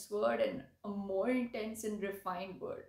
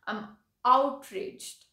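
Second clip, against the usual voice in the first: slow, much louder outraged "I'm outraged"; demo of outrage; 8 dB louder, much slower than usual. Shown as a demonstration. Teacher showing learners an outraged delivery. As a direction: lean hard into the anger, drawing it out. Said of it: "can you see the difference between saying".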